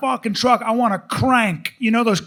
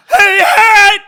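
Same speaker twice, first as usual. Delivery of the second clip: very high, loud yelp; dog-name call sound; 10 semitones higher, 11 dB louder than usual. Shown as a demonstration. Owner demonstrates his dog's name, high yelped shout. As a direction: belt out the name as a noise. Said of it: "his name's gonna be a sound".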